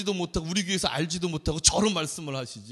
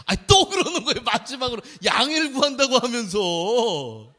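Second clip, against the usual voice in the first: laughing exasperation; 6 semitones higher, 8 dB louder than usual. Real, not acted.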